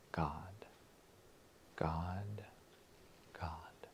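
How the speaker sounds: soft unvoiced whisper; whispered God. Shown as a demonstration. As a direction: breathe the name in a whisper. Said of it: "my silent war cry will be".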